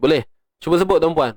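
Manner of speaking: normal talking voice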